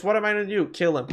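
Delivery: normal talking voice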